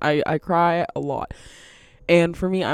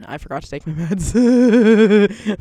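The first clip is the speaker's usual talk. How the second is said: loud, drawn-out, higher realization; mock dismayed outburst; 4 semitones higher, 6 dB louder, slower than usual. Telling coworkers about forgotten meds, mock dismayed outburst. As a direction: blurt it slowly with hammed-up dismay.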